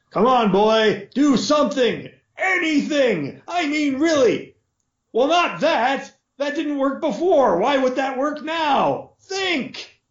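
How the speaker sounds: high exasperated coaching lord; a lord barking at his son, pitched high. Acted. Lord goading his struggling son, high and exasperated.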